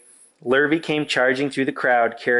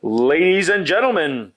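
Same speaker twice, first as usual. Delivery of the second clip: announcer; 4 semitones higher than usual. Acted. Fair loudspeaker announcement.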